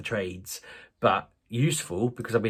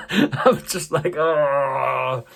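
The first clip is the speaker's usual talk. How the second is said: high, loud drawn-out wail through laughter; loud laughing "ohhh" of panic; mock panicked wail; 5 semitones higher, 9 dB louder, much slower than usual. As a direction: let out a long, high cry of comic dread. Mock panic at open water.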